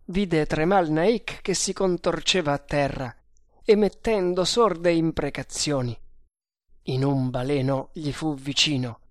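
ordinary delivery

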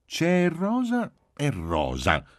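high, slow, pointed lecturing; drawn-out, high-pitched smugness; impatient know-it-all child. A narrator's reading. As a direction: spell out the difference like a little expert. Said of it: "con impaziente saccenteria".

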